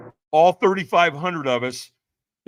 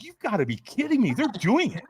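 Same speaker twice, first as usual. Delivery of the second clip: higher-pitched amazed reaction; retold disbelief; 7 semitones higher than usual. Watching people walk on coals, retold astonishment. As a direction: play up the incredulity.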